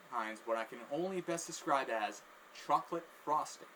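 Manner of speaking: ordinary talk, nothing marked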